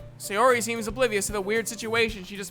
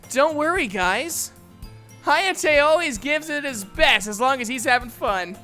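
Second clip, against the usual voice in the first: higher, lively reassuring voice; cheerful friend; 4 semitones higher, 3 dB louder than usual. Acted. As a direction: chirp it happily, stretching out the last items. Cheerful friend praising Hayate.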